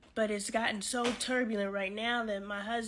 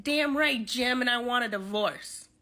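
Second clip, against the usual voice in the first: defiant wife; 7 dB louder than usual. Retold or imitated. Wife answering her husband, a loud defiant shout. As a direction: shout it back with no regrets. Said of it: "She's like".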